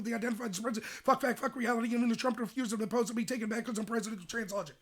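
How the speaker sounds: rattled off fast, piling up jargon; breathless speed-read of buzzwords; mock debate spreading. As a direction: spit out nonsense at top speed like a debate spreader. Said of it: "this is how they would talk in debate".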